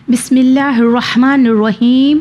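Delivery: melodic chanted recitation of the basmala; Quran recitation. Shown as a demonstration. Recites before lesson.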